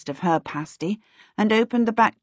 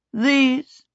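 formal elf; 7 semitones higher than usual. Acted.

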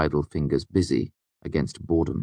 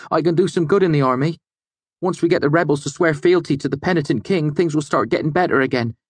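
higher, louder, fast, fired up; a hot-headed lad pleading his cause, raised and fast; heated young prentice; 11 semitones higher, 4 dB louder than usual. A narrator's reading. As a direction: argue hotly with boyish conviction.

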